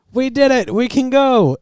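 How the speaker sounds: high, loud triumphant coach yelling; cheering coach. Retold or imitated. Coach John Candy bursts in on the police, high and loud in triumph. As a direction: yell it like a coach whose team just won.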